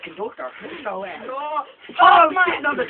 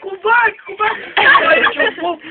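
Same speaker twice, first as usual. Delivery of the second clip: higher, louder mock call; 8 semitones higher, 7 dB louder than usual. Brandon calling after the lifted Leanne, mock shout. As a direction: shout after someone leaving. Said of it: "Brandon's down here goes".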